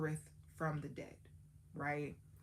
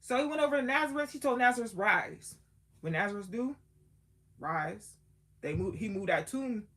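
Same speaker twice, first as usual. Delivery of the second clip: loud, higher sermon voice, rushing at first; preaching; 3 semitones higher, 5 dB louder than usual. Acted. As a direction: preach it to the congregation. Retelling the sermon with fervor.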